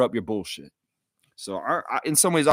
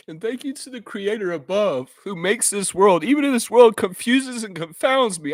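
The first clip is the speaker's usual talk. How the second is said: emotional testimony; 6 semitones higher than usual. Real, not acted. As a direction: voice pushed high, straining with feeling. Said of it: "I apologize for getting emotional".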